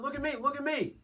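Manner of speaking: very high, soft, squeezed calls; a high falsetto plea; mock pleading call. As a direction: beg her to look. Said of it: "she just hears".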